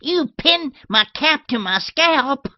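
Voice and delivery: high, loud, shrieking sarcasm, climbing at the end; old lady rising to an outraged shriek; sarcastic tirade. A narrator's reading. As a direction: heap scorn on the maid. Old lady berating the maid.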